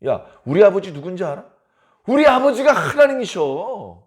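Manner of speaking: acted proud brag; boasting. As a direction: show off about a father. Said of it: "이렇게 자랑을 한다는 겁니다".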